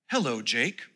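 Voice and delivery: low, soft, calm British assistant voice; a smart speaker speaking in a calm British voice. A narrator's reading. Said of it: "it said in a calm British voice".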